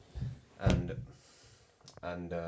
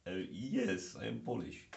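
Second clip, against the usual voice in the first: put-on Eastern European accent; playful imitated Eastern European accent; mock Eastern European accent; 3 semitones higher, 4 dB louder than usual. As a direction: answer firmly in a fake Eastern European accent. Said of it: "I was Lukov or Sergei".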